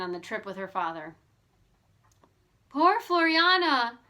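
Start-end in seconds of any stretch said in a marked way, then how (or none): 2.7-4.0 higher, louder, slow cry of sympathy; narrated heartfelt pity; pitying exclamation. A narrator's reading. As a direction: mourn the poor dancer aloud. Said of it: "Bess exclaimed".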